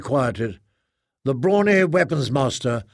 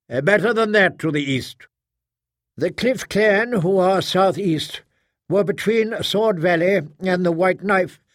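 higher-pitched, declamatory proctor; proctor holding forth; 4 semitones higher than usual. Acted. Proctor explains the traitor to his officers, higher declamatory voice. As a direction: address the assembly in a raised pitch. Narrator voices Wyatt answering.

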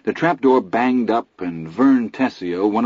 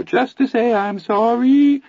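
very high wavering falsetto singing; 4 dB louder, slower than usual. A narrator's reading. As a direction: croon it in a dreadful falsetto. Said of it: "dreadful little Anthony falsetto".

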